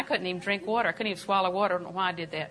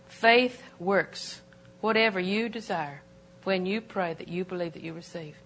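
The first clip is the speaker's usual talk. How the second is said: normal talking voice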